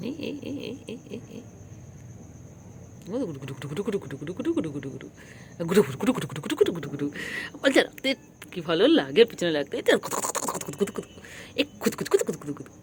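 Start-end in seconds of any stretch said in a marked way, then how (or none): 0.1-1.4 whispered repeated calls; soft hushed calls. Real, not acted. Calling her cat, soft hushed calls. 3.1-7.0 soft babbling coo; cooing nonsense. Real, not acted. To her cat, soft babbling coo. 9.8-12.7 rapid hushed chatter; quick soft babble. Real, not acted. Playing with her cat, quick hushed babble.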